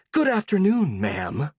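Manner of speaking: venomous politeness. Acted. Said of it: "seemingly in a polite tone, but putting as much venom".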